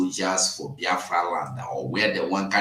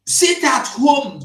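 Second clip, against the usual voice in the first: 1 slow shouted warning; 8 semitones higher, 6 dB louder, slower than usual. Real, not acted.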